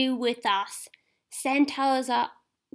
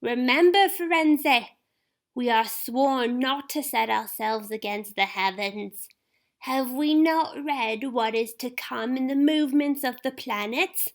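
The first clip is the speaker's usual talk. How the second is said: reminding Firenze of their oath; stern centaur; 7 semitones higher than usual. Acted. Lane voiced.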